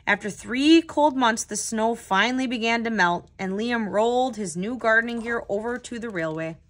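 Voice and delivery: put-on steady read-aloud voice for young listeners; unhurried children's story narration; storybook narration. A narrator's reading. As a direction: read it like a picture book to a class. Reads picture book aloud.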